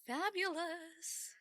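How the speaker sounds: high, slow, sing-song fabulous; drawn-out fabulous. Real, not acted. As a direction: stretch the word out with delight. Gushing about a nice day.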